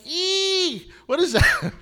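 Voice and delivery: high squeal of mock disgust; a squeaky girl voice reacting to something gross, lightly played; imitating his daughter. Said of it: "she's like".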